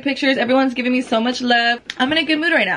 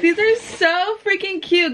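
higher, slower, gushing; excited; 7 semitones higher, slower than usual. Real, not acted. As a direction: gush over the shoes. First sight of the gift.

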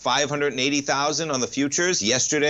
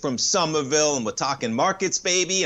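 high, bright announcer lift welcoming listeners back; upbeat radio host; 4 semitones higher than usual. Acted. Radio host welcomes listeners back, upbeat and higher. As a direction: pump up the listeners coming back from break. Host opens segment on air.

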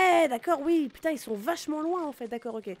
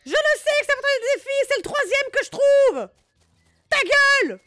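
high, loud exasperated yelling; angry shouting; 12 semitones higher, 11 dB louder than usual. Pretend. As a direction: yell back, fed up. Continues yelling at character.

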